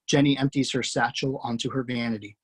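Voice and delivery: ordinary talk, nothing marked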